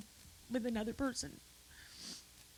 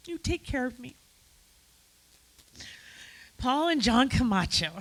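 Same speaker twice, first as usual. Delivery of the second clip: ordinary talk, nothing marked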